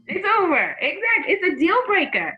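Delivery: high, loud, animated verdict; emphatic agreement. Real, not acted. Agreeing with the audience that the date is over, emphatic. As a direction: seize on the answer and ring it out.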